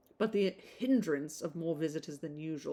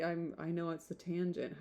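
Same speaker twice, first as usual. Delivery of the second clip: ordinary delivery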